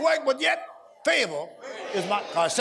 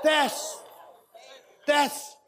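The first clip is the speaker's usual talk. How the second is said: emphatic proclaiming; 4 semitones higher, much slower than usual. Real, not acted. Preacher building to a climax.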